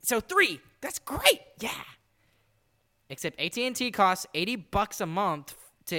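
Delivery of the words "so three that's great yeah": loud, high-pitched fake enthusiasm; sarcastic. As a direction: cheer it as if thrilled, insincerely. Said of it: "I'm being sarcastic".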